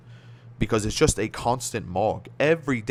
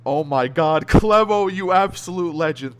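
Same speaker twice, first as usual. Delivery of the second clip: thrilled shout; 5 semitones higher, 6 dB louder, slower than usual. Real, not acted. Thrilled by a viewer's donation.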